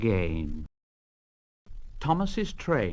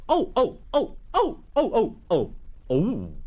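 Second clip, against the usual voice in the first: bumped coaches; 8 semitones higher than usual. Acted. Thomas bumps the coaches.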